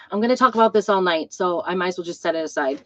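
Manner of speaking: normal talking voice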